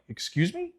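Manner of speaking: soft, high, rising question; hushed incredulous query, pitch lifting; retold disbelief. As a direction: quietly act out being taken aback. Retold disbelief at position change.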